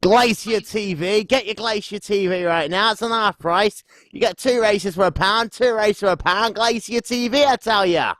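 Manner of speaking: put-on high-pitched street-seller patter in regional accent. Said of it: "George Attenquingo accent".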